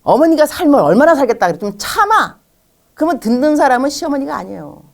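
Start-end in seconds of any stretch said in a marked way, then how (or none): 0.0-2.4 dismissive husband. Retold or imitated. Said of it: "남편이 뭐라 하냐면".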